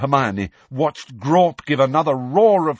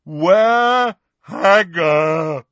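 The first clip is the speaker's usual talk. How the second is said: very slow, loud bellowed question; giant roar; 6 semitones higher, 8 dB louder, much slower than usual. A narrator's reading. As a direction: roar each word out long, demanding an answer. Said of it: "roared Grawp".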